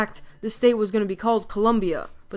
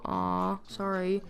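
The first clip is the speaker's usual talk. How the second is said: softer, tender sorry; softer mock-tender aw sorry; soft apology; 7 dB quieter, much slower than usual. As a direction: gently apologise to a sad friend. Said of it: "don't cry".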